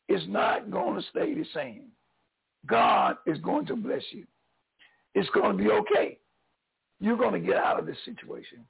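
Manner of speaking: normal talking voice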